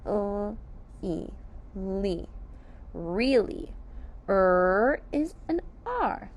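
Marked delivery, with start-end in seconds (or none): 0.1-2.3 ly sounded L, e, ly; sounding out. Shown as a demonstration. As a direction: sound out ly one sound at a time. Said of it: "Let's sound out ly".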